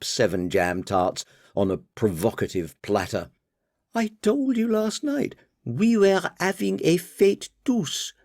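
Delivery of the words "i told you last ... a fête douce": put-on high, flowing French accent on fête douce. Daughter correcting her father, flowing French accent. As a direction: toss off the French like a Parisian. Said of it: "retorted M in a flowing French accent".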